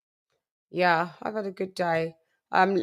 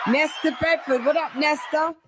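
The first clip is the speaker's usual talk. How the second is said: hype shout-out; 5 semitones higher than usual. Real, not acted. Hyped roll call shout.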